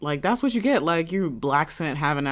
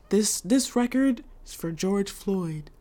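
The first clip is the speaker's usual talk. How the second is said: mock solemn DJ; 4 semitones higher, slower than usual. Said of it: "he was like".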